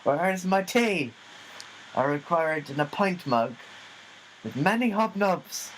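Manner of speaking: high, imperious diva voice; pampered star ordering tea; diva demand. Pretend.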